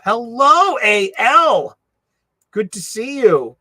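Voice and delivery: high, loud hello; cheerful shout-out; bright greeting. Real, not acted. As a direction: call out a welcome to a friend. Greeting a chatter.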